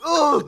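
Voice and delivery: loud high mock moan. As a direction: ham up a dying groan. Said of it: "Oh, le cri".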